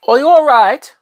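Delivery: high, loud mock British; overdone British accent, pitched high; bad British accent. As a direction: put on a terrible British accent. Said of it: "That was a horrible British accent".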